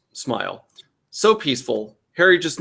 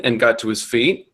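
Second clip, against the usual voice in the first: ordinary delivery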